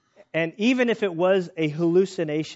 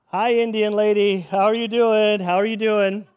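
louder, higher hammed-up hello; mock greeting call; 6 semitones higher, 6 dB louder than usual. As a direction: call out cheerfully across the water. Crew waves to imaginary lady.

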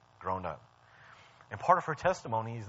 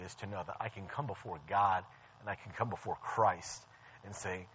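ordinary delivery

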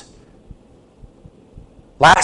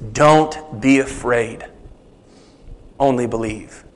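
gentle reassurance; 6 semitones lower, 5 dB quieter than usual. Retold or imitated. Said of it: "Jesus says".

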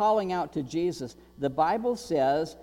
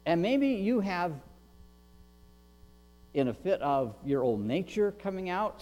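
ordinary talk, nothing marked

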